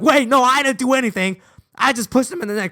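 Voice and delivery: high, loud mock pleading; panicked protest. Boy pleads with the principal, high panicked mock protest. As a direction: blurt out a squeaky denial.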